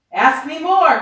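loud, high witch's cry. A narrator's reading. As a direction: raise the voice and demand it. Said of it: "cried the old witch".

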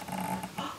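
quick audible intake of breath; small mock gasp; pretend surprised gasp. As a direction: gasp as if just realising. Pretend surprise before answering question.

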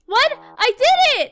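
high, loud shout of protest; sudden high-pitched outburst; outraged disbelief. Real, not acted. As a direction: yell it in shocked objection. Protests after completing the task.

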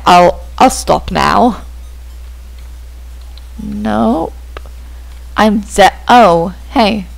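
ordinary delivery

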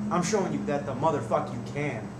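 ordinary delivery

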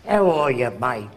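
a lightly voiced Aussie greeting, dropped pitch; Australian accent. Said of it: "that guy comes from Australia".

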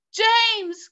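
high, loud call of a name; crying out. A narrator's reading. Earthworm to the boy as they fall, crying out. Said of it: "cried the earthworm".

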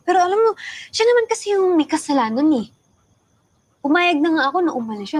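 venting angrily, voice rising; angry. Acted. Venting about Daniel, rising anger.

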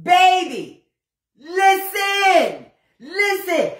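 high, drawn-out calls for attention; dramatic exclaiming. Real, not acted. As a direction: stretch each word high, hyping up the story. Calling viewers to attention dramatically.

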